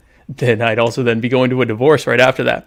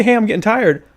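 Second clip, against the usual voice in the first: lightly voiced, higher-pitched quote; quoted listener; 7 semitones higher than usual. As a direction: play someone begging off, pitched up. Acts out a tired listener.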